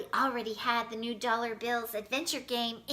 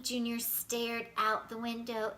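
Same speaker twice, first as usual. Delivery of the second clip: ordinary delivery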